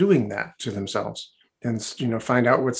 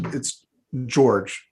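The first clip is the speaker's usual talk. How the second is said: ordinary talk, nothing marked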